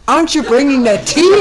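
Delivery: loud, high demanding shout. Retold or imitated. Abbot shouting at the attendants for his tea, loud. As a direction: yell for the tea across the temple. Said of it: "Now I'm shouting".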